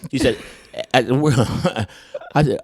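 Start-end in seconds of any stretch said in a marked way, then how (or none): none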